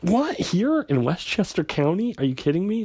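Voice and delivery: high, disbelieving cop scoffing at a bear story; incredulous. Retold or imitated. A cop scoffing at a bear attack in Westchester, high and incredulous. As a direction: shoot it up in disbelief, like it's absurd.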